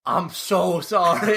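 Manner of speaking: slow, drawn-out retold apology; mortified apology. Apologizing to a stranger mistaken for Ben, mortified. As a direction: stretch out SO in mock embarrassment.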